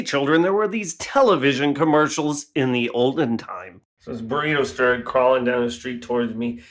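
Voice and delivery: normal talking voice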